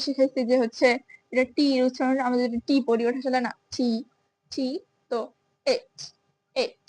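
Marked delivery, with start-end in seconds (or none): none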